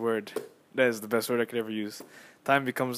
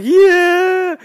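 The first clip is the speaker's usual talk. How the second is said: loud, much higher roaring "Yeah"; crowd cheer; 17 dB louder than usual. Retold or imitated. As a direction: roar like the arena when he checks in. Crowd cheers Lonzo coming in.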